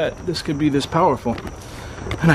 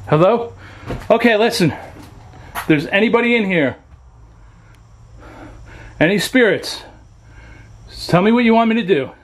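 calling out to spirits; 8 semitones higher than usual. Real, not acted.

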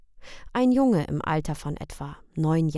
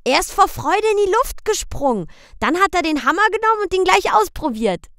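put-on high, fast excited child; eager children; 12 semitones higher, 9 dB louder than usual. A narrator's reading. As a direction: tumble out the story like a thrilled kid. Boy Michel tells.

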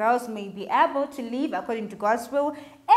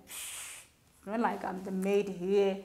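sulky retort; 4 semitones lower, 8 dB quieter than usual. Retold or imitated. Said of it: "Tino will be like".

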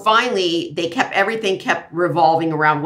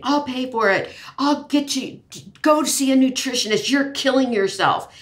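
mild mock yell from a worried friend; mock scream; 8 semitones higher than usual. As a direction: imitate a friend's frantic scolding with a raised voice. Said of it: "screaming at me".

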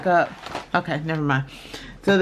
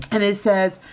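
ordinary talk

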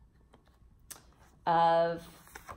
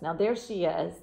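ordinary delivery, nothing marked

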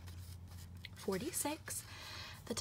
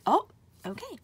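sudden louder higher oh of surprise; surprised; 6 semitones higher, 8 dB louder than usual. Real, not acted. Scratching a ticket, suddenly sees a seven, surprised. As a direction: caught off guard mid-sentence.

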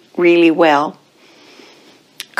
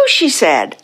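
usual speaking voice

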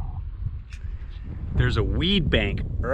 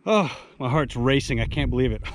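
ordinary talk, nothing marked

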